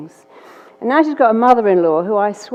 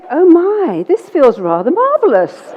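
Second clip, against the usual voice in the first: delighted; 8 semitones higher, 5 dB louder than usual. Retold or imitated. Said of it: "And she went".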